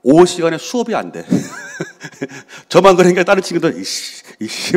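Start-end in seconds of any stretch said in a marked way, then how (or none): 1.3-2.2 burst of laughter. Real, not acted. Laughing at own story. 3.8-4.8 unvoiced under-breath "이씨"; muttered grumble. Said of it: "하면서 수업이 안 돼".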